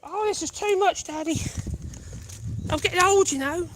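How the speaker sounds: a hint of a weary old dog; voicing the old dog. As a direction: speak for the panting dog. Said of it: "he's so out of breath again".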